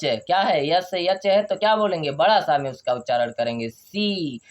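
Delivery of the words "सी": drawn-out 'shee' or 'see' for सी; mistaken syllable. Shown as a demonstration. Teacher slips before correcting himself, long ee syllable. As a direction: say सी with a long 'ee'. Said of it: "सी क्यों बोल दिए भाई?".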